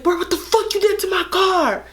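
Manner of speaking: put-on angry boyfriend.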